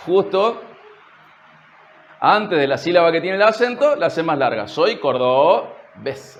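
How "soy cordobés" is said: slow Córdoba accent with the pre-stress syllable stretched long; Córdoba accent demo. Shown as a demonstration. Preacher showing the congregation how Córdoba people talk, in a slow Córdoba accent. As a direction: drag out the syllable before the stress, Córdoba style. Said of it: "Hay que acentuar la sílaba posterior a la sílaba tónica".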